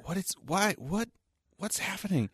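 high, soft baffled voice; high bewildered whisper-ish questions; confused friend. Retold or imitated. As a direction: squeak out total confusion. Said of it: "Andrew's next to me going".

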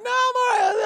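very high comic protest; high-pitched holler of denial; hammed-up insistence. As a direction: shout back in mock denial. Insisting back before noticing injury.

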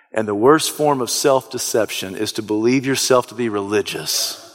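ordinary talk, nothing marked

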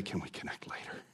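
a confiding whisper asking for help; hushed whisper. Pretend. Churchgoer quietly asking a friend for help, whispered. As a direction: drop to a whisper, quick and private.

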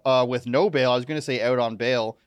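ordinary talk

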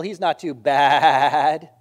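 loud, long drawn-out bleat on "bad"; corny dad-joke sheep bleat. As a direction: stretch "bad" into a sheep's baa. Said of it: "that sheep says".

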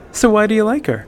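pry at him playfully; teasing female voice; curious Ayala. A narrator's reading. Ayala prodding.

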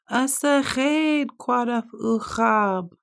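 high slow wailing words; crying beaver. A narrator's reading. Lonely beaver wailing by the pond. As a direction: sob the words out slowly. Echo across water.

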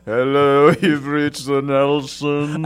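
loud, drawn-out, very slow put-on greeting; answering machine voice. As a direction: stretch it out like a silly phone recording. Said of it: "I don't know what that voice is".

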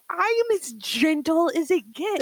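high, hammed-up angry retort; mock furious insistence. Snapping back at brother about being gentle, mock fury. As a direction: insist through mock rage. Shouted retort while pounding table.

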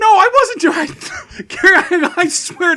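high, loud laughing protest. Real, not acted. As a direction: laugh while you deny it. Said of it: "laughing denial after accusation".

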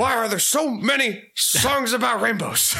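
high put-on Kermit-like voice; Tony Clifton impression. Waving hands like Kermit, doing a Tony Clifton voice. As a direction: ask it in a high, goofy character voice. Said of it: "Now you're just doing a Tony Clifton".

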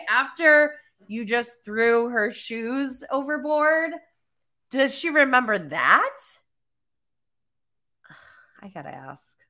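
normal talking voice

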